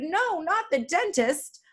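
high, drawn-out mock dismay; shouted protest. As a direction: plead in slow, high-pitched protest. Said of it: "I shouted".

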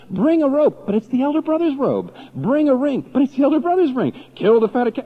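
high, loud commands; father's orders shouted high and loud; ringing father's command. Acted. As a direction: call out each order with rising force. Preacher building to a climax.

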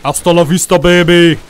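loud, lightly voiced action hero; Terminator catchphrase. Gamer gloating over a bot, loud one-liner. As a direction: deliver it like a movie one-liner.

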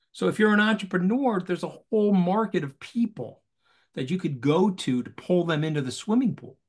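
usual speaking voice

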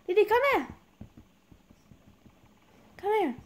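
high, sing-out calling voice; luring call. Pretend. Luring the game character over.

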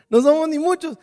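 riendo. Real, not acted. Repite riendo ante la iglesia.

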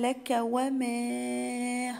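drawn-out tajweed chant, each syllable held; slow Quran recitation. Shown as a demonstration. Teacher recites the verse to students as a slow chant. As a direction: recite it slowly for the students, holding the long vowels. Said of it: "مد أربع حركات".

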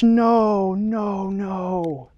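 usual speaking voice

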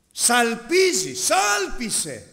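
slow, loud divine order; booming command. Acted. God tells the preacher to sound the trumpet, booming slowly.

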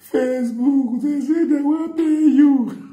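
high, slow, squealing wife voice; excited wife. Retold or imitated. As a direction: squeal the news like the thrilled wife. Wife excitedly shares the news.

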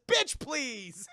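loud, high, laughing scorn; hammed-up brush-off; dismissive sass. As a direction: toss it out with relish. Acting dismissive line, amused.

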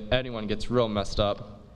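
normal talking voice

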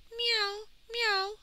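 high, thin kitty mew; two short high meows; cat meow. A narrator's reading.

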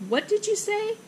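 high-pitched, puzzled question; straining to hear. Acted. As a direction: ask it high, as if over a din. Straining to hear over noise.

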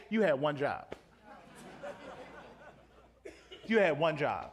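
dry sarcastic reproach. Retold or imitated. Peter at heaven's gate, sarcastic to a believer. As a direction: let the words drip with disappointment. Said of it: "hear Peter sarcastically say".

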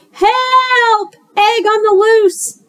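high, loud call for help; shouted. A narrator's reading. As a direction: shout it out in a panic. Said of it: "shouted Bear".

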